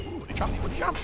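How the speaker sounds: breathy high whisper; excited whisper. Real, not acted. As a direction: whisper it with glee.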